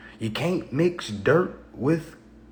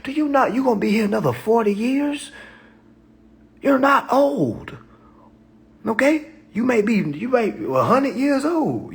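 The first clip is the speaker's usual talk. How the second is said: exhorting; 9 semitones higher, 4 dB louder than usual. Retold or imitated. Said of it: "I'm like".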